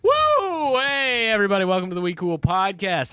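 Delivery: loud, high whoop into a show welcome; high, excited podcast intro; high-energy opening. Pretend. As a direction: kick off the show with big energy. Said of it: "High energy beginning this time".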